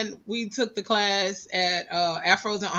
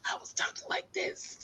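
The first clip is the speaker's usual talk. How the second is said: whispered imitation of a lost voice; 13 dB quieter than usual. Shown as a demonstration. Showing friends how she sounded when sick, voiceless whisper. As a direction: demonstrate having no voice left, whisper only. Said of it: "when I say voice was gone".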